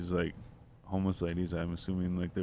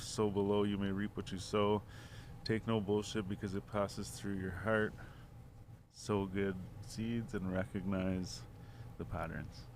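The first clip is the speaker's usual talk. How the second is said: slow recitation; 8 dB quieter, slower than usual. Retold or imitated. Repeating a stranger's saying to friends, slow recitation. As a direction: recite it softly, pausing between phrases.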